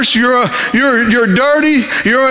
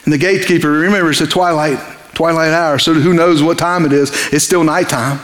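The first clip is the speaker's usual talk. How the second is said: normal talking voice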